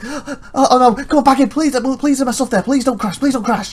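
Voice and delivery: high, breathless mock panic; acting out a racing-heart plea; panicked pleading. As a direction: beg frantically in a high voice. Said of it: "my heart was like".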